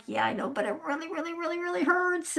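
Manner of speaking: mock-whine about how much it hurts; whiny complaint. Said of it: "And I'm like".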